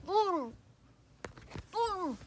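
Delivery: muffled behind tape over mouth; mouth taped shut. Acted. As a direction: say it through taped lips. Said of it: "Puts tape over mouth".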